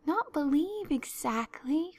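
high, slow, hesitant reply; faltering. A narrator's reading. Anne hedges under Marilla's questioning, faltering. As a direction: hedge uncertainly, drawing it out. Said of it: "faltered Anne".

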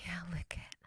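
soft breathy whisper. Acted. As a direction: whisper it close to the ear. Intimate whisper to partner.

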